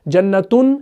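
جنتن ending in "-tun", rising. Shown as a demonstration. Teacher reciting the singular's first case, -un ending on جنتن. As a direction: say جنتن as jannatun, short u before the n. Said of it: "یہ واحد ہے".

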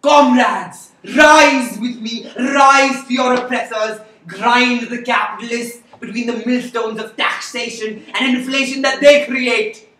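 loud, high rallying shout; fiery revolutionary speech. Acted. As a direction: rouse the crowd, slow and loud.

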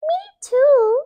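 very high, loud squeak of a mouse; field mouse. A narrator's reading. Field mouse chiming in, squeaky and high. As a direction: pipe up like a tiny mouse. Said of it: "said a field mouse".

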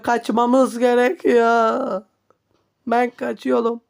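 ordinary delivery

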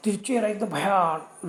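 ordinary delivery, nothing marked

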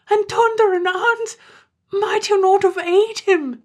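high, slow, loud cry; anguished wail. Acted. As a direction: howl it in horror. Terence wails over plucking father.

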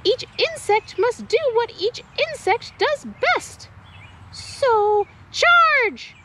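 rally the bugs to charge; rallying shout. Acted. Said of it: "shouted out".

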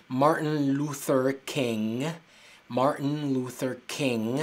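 Martin Luther King said very slow, ending King in ng; careful Martin Luther King. Shown as a demonstration. Teacher using Martin Luther King as an example of ng. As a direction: slowly spell out Martin Luther King with a nasal ng. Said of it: "se pronuncia con este sonido".

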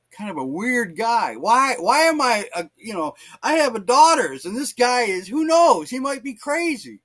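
high, loud acted protest; exasperated complaint. Father complaining to God about the neighbour, acted exasperation. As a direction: imitate a worried father's rising protest.

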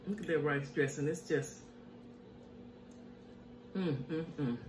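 ordinary talk

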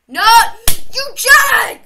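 high, loud shout of outrage; angry yell. Real, not acted. Player yelling at the enemy that caught them, loud outburst. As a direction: yell the insult.

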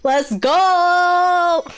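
usual speaking voice

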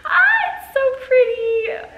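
high, loud, slow laughing cry of joy; overwhelmed with delight; giddy shriek through laughter; laughing squeal. Real, not acted. Overjoyed at a bikini.

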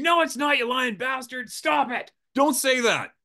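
high, loud shouted denial; mock screaming. The guide screaming at the surgeon, a high loud mock shout. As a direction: scream it at him in horror. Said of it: "now I'm screaming".

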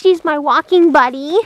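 normal talking voice

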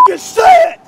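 high furious yell; angry shout. Acted. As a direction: scream the order at him. Instructor demands recruit say it.

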